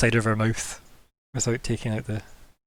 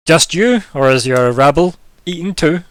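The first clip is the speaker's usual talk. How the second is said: louder, higher, sneering madam; haughty madam; 5 semitones higher, 8 dB louder than usual. Acted. The madam sneering at the dwarf's companions, louder and higher. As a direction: look down your nose and call it out. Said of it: "Madame Emerald just goes".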